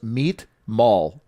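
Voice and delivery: put-on clipped Armenian accent on "Meet Mall". Friend imitates Armin's Armenian accent on a text. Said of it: "In his accent".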